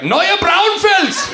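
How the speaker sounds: high, drawn-out German "Neue Braunfels"; German pronunciation. Retold or imitated. German mom renaming the town as mayor, high German accent. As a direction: proclaim "Neue Braunfels" slowly in a shrieky, hammed-up German accent. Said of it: "she would probably change the pronunciation".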